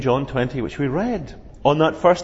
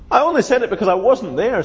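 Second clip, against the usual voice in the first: quick, higher, indignant Thomas; Thomas protesting; 6 semitones higher, 3 dB louder, faster than usual. Acted. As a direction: rush the excuse a little higher. Thomas voiced in story.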